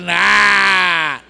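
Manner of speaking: sudden blaring Nah; loud punchline shout. Real, not acted. Preacher nailing the joke with a shout. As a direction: blast the word out for laughs.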